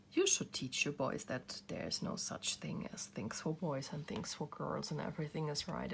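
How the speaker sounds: ordinary talk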